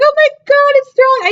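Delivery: high, thrilled fan outburst; excited gasp. Retold or imitated. Seeing Thrawn return on screen, thrilled. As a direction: blurt it like a fan spotting a hero. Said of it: "I was like".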